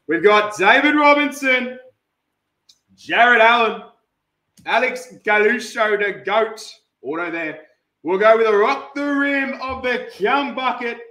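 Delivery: punchy player call; lively card-break announcing; hype announcer. Acted. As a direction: call out each name with force.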